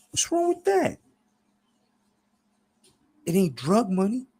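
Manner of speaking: high, wheedling smooth talker; cajoling falsetto-like lilt, dropping briefly mid-speech; Clever, smiling. A narrator's reading. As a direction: placate him in a very high, smiling voice. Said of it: "says with a smile".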